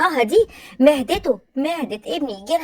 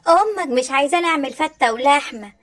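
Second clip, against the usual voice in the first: ordinary talk, nothing marked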